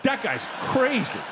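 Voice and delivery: ordinary talk, nothing marked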